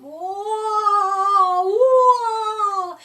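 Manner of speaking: high, loud alarmed whoa; stumbling and losing balance. A narrator's reading. Cat stuck in pumpkin stumbling, high alarmed whoa.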